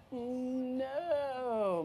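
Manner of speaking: long, high groan sliding down; dismayed groan. Acted. Mom hearing dinosaurs come with everything, dismayed groan. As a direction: drag it out, sinking in despair. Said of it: "my mom groaned".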